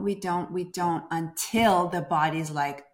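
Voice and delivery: usual speaking voice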